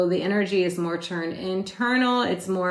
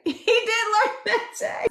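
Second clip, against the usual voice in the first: 1 laughing. Real, not acted. Laughing about the bully story.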